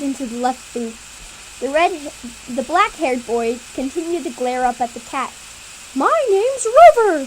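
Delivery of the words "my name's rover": high, louder cat voice; the cat introduces himself, pitched up. Acted. Cat giving its name to the kids, high and louder. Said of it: "says the cat".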